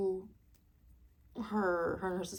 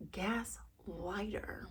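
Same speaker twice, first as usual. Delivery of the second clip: whispered; 8 dB quieter, much slower than usual. Real, not acted. Vlogger whispering her verdict on the husband, slow. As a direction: whisper the last word slowly. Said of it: "whispered punchline".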